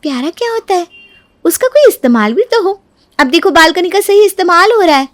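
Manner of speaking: high-voiced dismissal; scoffing sister-in-law. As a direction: scoff at her sentiment. (तिरस्कार भरी ऊँची आवाज़)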